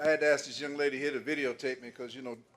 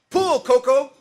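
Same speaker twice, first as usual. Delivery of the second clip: loud high call; calling out to the mule; 10 semitones higher, 11 dB louder, slower than usual. Retold or imitated. Old farmer shouting to his mule to pull the car, loud call. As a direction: shout the command across the field.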